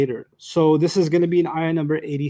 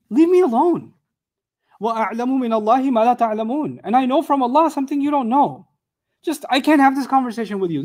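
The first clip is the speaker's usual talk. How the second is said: exasperated father; 5 semitones higher, faster than usual. Acted. Grieving father tells his sons to leave him alone, exasperated. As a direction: push them away like a grieving father. Voicing Yaqub rebuking sons.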